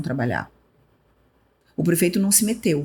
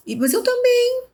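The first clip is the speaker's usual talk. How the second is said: quoting colleague. Retold or imitated. Colleague answering her, voiced much higher. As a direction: squeak it up as the colleague.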